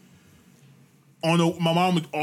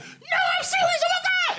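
very high mock-mom squeal; excited squeaky shriek. Imitating mom insisting the win is real, excited squeaky shriek. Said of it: "loud squeaky voice when she's excited".

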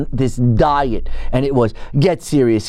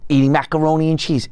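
normal talking voice

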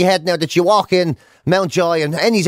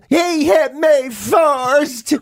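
drawn-out, higher mock child's whine; whiny tattling child; 6 semitones higher, much slower than usual. Prisoner telling the warden on another, childish whine. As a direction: stretch it out like a kid telling on someone.

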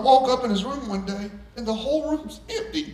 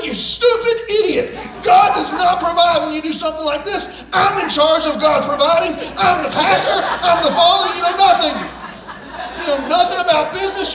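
high, loud, laughing shout; hammed-up furious pastor dad; retold screaming rant; 6 semitones higher, 8 dB louder than usual. Pastor dad screaming at his son about business. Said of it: "crying and screaming at each other".

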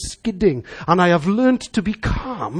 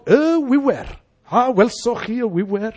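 put-on a child's singsong recitation of a Welsh poem; recited Welsh verse; 5 semitones higher than usual. Preacher recalls a child reciting for the Eisteddfod, singsong verse. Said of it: "learn a verse for the Eisteddfod".

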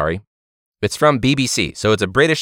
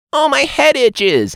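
much higher and slow, a silly cutesy animal voice; mock squirrel voiceover; 3 dB louder, slower than usual. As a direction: dub the squirrel like a cheesy nature show. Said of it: "it's the voice of the squirrel".

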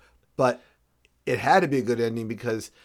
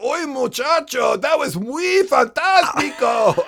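high, slow hammed-up Spanish accent; a hammed-up Spanish accent, high and stretched; mock Spanish accent; 10 semitones higher, 6 dB louder than usual. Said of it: "he comes in with".

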